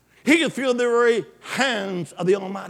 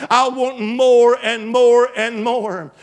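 drawn-out, pleading "more and more and more"; longing plea, each "more" slow and heavier; slow pleading cry; 4 dB louder, slower than usual. Acted. Congregation's cry voiced by preacher.

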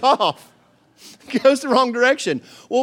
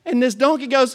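ordinary talk

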